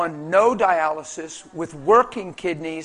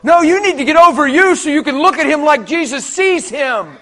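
loud, high fervent preaching; full-force preaching; 7 semitones higher, 9 dB louder than usual. Acted. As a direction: preach it out with all your fire.

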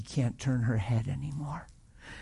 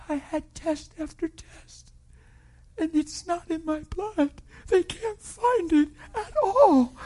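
high, slow, tearful joy; woman bursting with happy tears to the pastor; beaming; 14 semitones higher, slower than usual. Retold or imitated. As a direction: tell it overjoyed, much higher. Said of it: "beaming ear to ear".

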